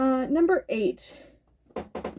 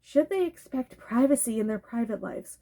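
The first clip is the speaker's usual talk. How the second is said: ordinary talk, nothing marked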